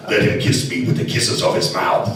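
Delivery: creepy, deep masked-villain voice; Darth Vader. Acted. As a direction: read the love verse low, like a dark lord. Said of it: "that would be cool as Darth Vader".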